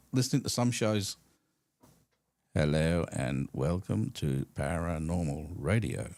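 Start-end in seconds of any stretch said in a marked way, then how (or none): 2.6-6.1 slow, low paranormal-show intro; mock radio host. Co-host mimics old paranormal shows opening on air, slow low mock-host voice. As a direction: imitate a ponderous late-night radio host. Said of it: "That's how it was".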